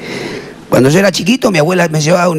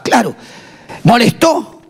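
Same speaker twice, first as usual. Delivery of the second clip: ordinary delivery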